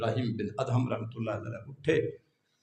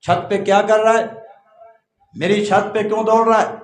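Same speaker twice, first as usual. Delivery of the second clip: a loud call up to the roof, higher; 9 semitones higher, 13 dB louder than usual. Acted. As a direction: shout at the stranger on the roof. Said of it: "تو زور سے آواز دی". (زور سے پکارنا)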